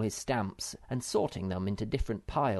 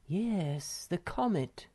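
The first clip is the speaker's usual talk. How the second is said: anxious; 8 semitones higher, slower than usual. Acted. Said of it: "said Moomin Mamma anxiously".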